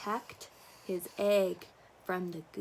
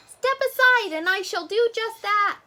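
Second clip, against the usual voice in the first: duck voice; 12 semitones higher, 9 dB louder than usual. Acted. Duck pushing past.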